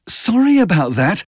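bright, tight and high, forced cheer; tense joy. A narrator's reading. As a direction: sound happy but strained underneath. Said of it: "with a kind of tense joy in her voice".

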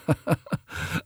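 soft, acted chuckle; short laugh. A narrator's reading. Lord Henry amused at Dorian's friend, short laugh. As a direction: laugh it off in a quick burst. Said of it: "Lord Henry laughed".

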